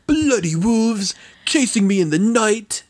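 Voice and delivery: lightly hammed-up fake British accent; Keanu Reeves British accent. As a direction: ham up a terrible Keanu-style British accent. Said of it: "Keanu Reeves's horrible British accent".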